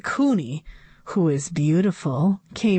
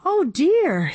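dismayed; 9 semitones higher than usual. A narrator's reading. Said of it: "said Missus Yonkers".

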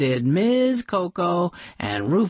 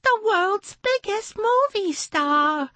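much higher, starstruck fan voice; gushing poodle. Acted. Poodle in pearls gushes to the guests, much higher. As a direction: gush like a dazzled fan.

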